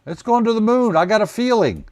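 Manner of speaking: high, loud, hammed-up refusal to cash out; greedy trader. Trader refusing Ralph's advice to get out, greedy and hammed-up. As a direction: stretch it out in mock greedy excitement.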